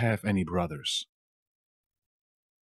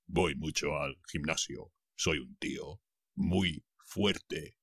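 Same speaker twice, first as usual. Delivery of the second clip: lower, softer lazy gym-bro voice; Chad the gym bro; 6 semitones lower, 7 dB quieter than usual. Acted. Teacher voicing Chad bragging about the gym, low and soft. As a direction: play a smug muscle guy, low and soft. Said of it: "dijo Chad".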